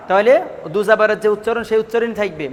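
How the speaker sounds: ordinary delivery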